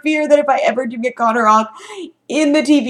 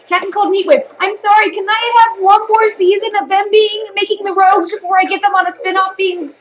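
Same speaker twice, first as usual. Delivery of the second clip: higher, louder fan rant; rising fan outburst; excited rant; 9 semitones higher, 3 dB louder than usual. Real, not acted. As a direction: gush about Captain Cold and Heatwave.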